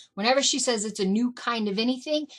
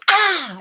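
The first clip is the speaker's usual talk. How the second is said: scare yell; 10 semitones higher, 13 dB louder than usual. Retold or imitated. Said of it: "I'd jump out at them".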